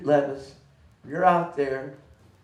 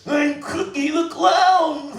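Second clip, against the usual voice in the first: a clown bragging that he is king of clowns; Cookie the Clown impression; 3 semitones higher than usual. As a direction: play the clown gloating, hitting each never. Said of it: "That's my imitation of him".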